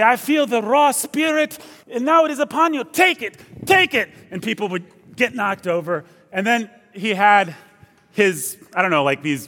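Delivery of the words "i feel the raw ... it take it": high-pitched faith-healer proclamation; Benny Hinn impression. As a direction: mimic a TV healer working the crowd, pitch climbing high. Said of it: "he would talk like this".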